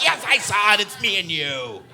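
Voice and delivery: a lightly voiced second self, slowing; reply to yourself, proud and smug; mock reply in another voice; answering yourself. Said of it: "And you're like".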